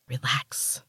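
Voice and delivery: sharp unvoiced hiss; hissed whisper. A narrator's reading. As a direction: hiss it to quiet her. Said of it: "Martina hissed".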